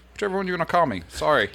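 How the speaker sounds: sheepish laugh. Retold or imitated. Sailor apologizing to the officer over his name, sheepish laugh. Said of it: "I was like".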